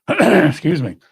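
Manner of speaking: hoarse, strained voice with throat clearing; losing voice. Real, not acted. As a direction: speak through a failing, hoarse voice. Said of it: "I'm losing my voice".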